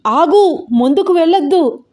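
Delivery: high, urgent cry to stop; clamouring. A narrator's reading. Passengers cry at the driver to stop, clamouring. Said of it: "ఒక్కటే గోల చేస్తున్నారు".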